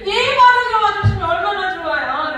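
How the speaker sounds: loud high-pitched comic grumble; hammed-up exasperated plea; mock protest. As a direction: cry out a playful complaint to God. Continues comic complaint to God.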